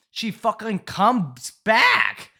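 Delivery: loud, high, drawn-out exclamation; incredulous reveal. Real, not acted. Storyteller revealing the crackhead girl came back, loud and incredulous. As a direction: stretch it out loud and high in disbelief.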